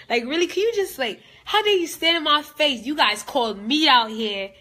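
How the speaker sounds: high, loud indignant outburst; furious outburst. Real, not acted. Angry outburst at the audience.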